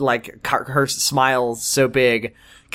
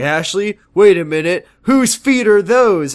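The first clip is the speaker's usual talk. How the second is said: louder, slow, rising alarm; mock alarm; 6 semitones higher, 4 dB louder, slower than usual. Retelling George finding feet in Ashley's bed, mock alarm. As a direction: drag out the discovery.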